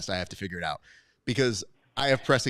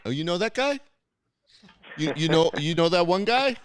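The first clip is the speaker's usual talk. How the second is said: higher-pitched mock New York questioning; imitated New York manner; 7 semitones higher than usual. Host teases guest's name-dropping, mock New York manner. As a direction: mimic a New Yorker, pitch climbing at the end. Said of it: "pulled the most New York way".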